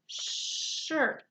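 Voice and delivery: "shirt" with the sh drawn out; stretched sh sound. Shown as a demonstration. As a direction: hold the sh of "shirt". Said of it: "Does shirt make the sh sound".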